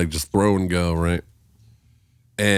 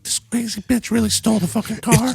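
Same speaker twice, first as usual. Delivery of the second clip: much higher, stunned disbelief; amazed report; 12 semitones higher than usual. Retold or imitated. Storyteller telling bandmates she really stole it, stunned. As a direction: tell them you can't believe it.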